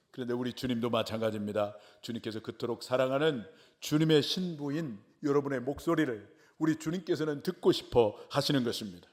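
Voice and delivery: ordinary talk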